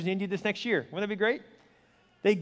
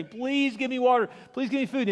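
imitated begging; 6 semitones higher than usual. Starving people begging outside store.

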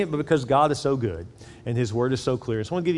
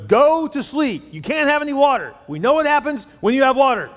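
dad yelling; 9 semitones higher, 4 dB louder than usual. Acted. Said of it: "His dad yells from the living room".

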